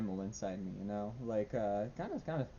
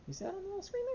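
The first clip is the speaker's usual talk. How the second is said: pet talk; much faster than usual. Real, not acted. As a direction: gently point at the screen for the dog. Showing dog the screen.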